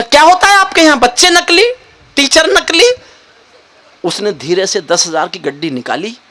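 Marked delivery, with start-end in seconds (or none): 0.0-2.9 much higher, indignant mock tirade; furious inspector. Inspector storming into the principal's room, mock fury. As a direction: rage at the principal over fakes. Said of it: "inspector गुस्से में".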